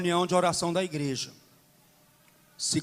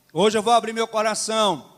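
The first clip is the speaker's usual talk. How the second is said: usual speaking voice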